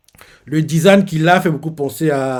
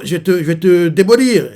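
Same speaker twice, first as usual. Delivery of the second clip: mock angry; 3 semitones higher, 6 dB louder than usual. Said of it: "Énervé, quoi".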